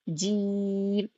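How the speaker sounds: جیم drawn out very slowly; جیم stretched long and level; long jeem. Shown as a demonstration. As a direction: hold جیم for five to six counts. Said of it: "دو سے تین الف کھینچیں گے".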